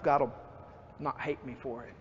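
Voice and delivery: usual speaking voice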